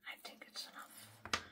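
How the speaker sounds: hushed, unvoiced whisper; very soft self-directed whisper. Real, not acted. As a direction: whisper it under your breath, as if thinking aloud. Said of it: "That's why I'm whispering".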